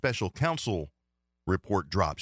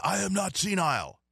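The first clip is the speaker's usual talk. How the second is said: mocking Biden; 10 semitones higher than usual.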